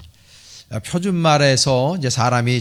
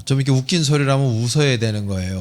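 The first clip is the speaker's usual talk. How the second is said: ordinary talk, nothing marked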